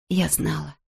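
quiet near-whisper, still voiced; soft whisper-like murmur. A narrator's reading. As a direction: murmur it gently, barely projecting. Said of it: "прошептала Нина".

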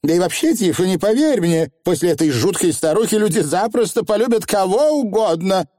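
high-pitched, gushing witch; self-important young witch. Acted. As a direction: insist smugly in a high voice. Voices Anagramma, boastful.

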